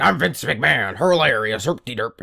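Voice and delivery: dopey mock boss voice; mock him as a dim, self-amused boss; lightly voiced goofy Vince impression; Vince McMahon. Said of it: "It's like".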